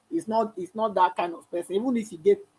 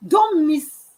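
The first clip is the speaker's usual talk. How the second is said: urgent plea; 9 semitones higher, 7 dB louder, slower than usual. Real, not acted. Pleading with a leader.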